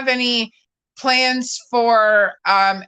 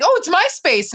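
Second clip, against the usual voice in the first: high casual voice; 9 semitones higher than usual. Retold or imitated. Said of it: "And they'll be like".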